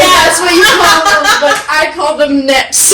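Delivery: laughing, high-pitched quote; laughing through it. Retold or imitated. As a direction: push the words out through laughter. Retold shop exchange, laughing.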